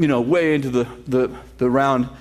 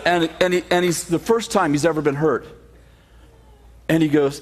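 normal talking voice

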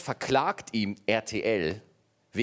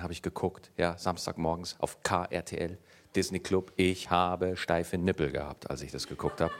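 nostalgic; 7 semitones lower than usual. Real, not acted. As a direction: reminisce fondly, voice dropping. Nostalgic lowered voice.

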